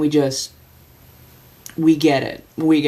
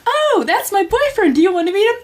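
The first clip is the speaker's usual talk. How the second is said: high bright voice; 3 dB louder than usual. Retold or imitated. Speaker's own quoted reply.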